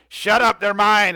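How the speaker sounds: jealous boatman shouting down a rival; angry shove-off. Acted. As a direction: bark it as you push him away. GM voices Dingy Pete shoving rival.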